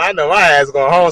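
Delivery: usual speaking voice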